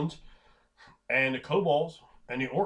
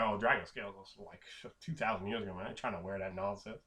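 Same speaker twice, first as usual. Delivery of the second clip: dismissive scoff; 5 semitones lower, 10 dB quieter than usual. Acted. Scoffing at outdated dragon armor.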